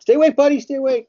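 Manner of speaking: high, loud cartoon coaxing; playful squeaky pep talk; duck voice. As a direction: urge a sleepy kid in a silly high voice. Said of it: "I'm doing duck voices and stuff".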